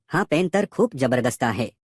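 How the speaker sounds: ordinary delivery, nothing marked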